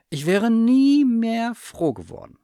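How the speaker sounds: high, loud heartfelt cry; emotional outburst. A narrator's reading. Child reunited with lost bear.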